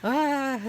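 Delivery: short startled gasp of "Ah.". Retold or imitated. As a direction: let out a small nervous yelp. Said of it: "I'm just like".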